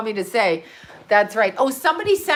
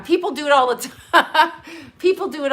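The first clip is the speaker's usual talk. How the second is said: laughing; 6 semitones higher than usual. Real, not acted. Laughing while talking.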